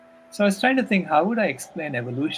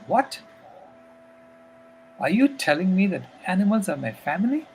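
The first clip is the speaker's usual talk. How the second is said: put-on surprised child; 5 semitones higher than usual. Granddaughter shocked, sits up.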